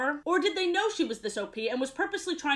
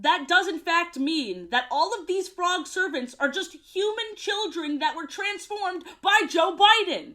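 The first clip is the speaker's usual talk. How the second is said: ordinary talk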